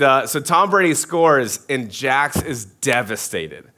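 ordinary delivery

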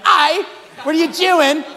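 put-on high, slow, loud piratical Aussie accent; pirate-like Aussie. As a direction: draw out a mock Aussie like a pirate. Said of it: "Aussies sound like pirates to me".

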